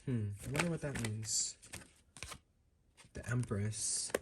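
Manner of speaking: soft, puzzled mumbling under her breath; muttering to herself. Real, not acted. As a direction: think aloud quietly, half to yourself. Puzzling over card meaning to herself.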